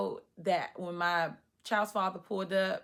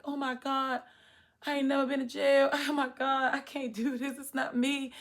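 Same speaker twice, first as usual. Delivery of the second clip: high-pitched pleading in distress; retold tearful panic; 7 semitones higher, 3 dB louder than usual. Praying in the back of the police car, panicked. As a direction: plead anxiously, voice raised high. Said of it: "praying, and I was crying".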